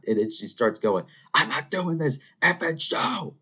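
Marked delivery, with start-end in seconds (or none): 1.3-3.4 high, strained imitation of a furious refusal; hammed-up outrage at being tricked; mock fury. Angie refusing the show, imitated high and furious. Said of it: "she's furious".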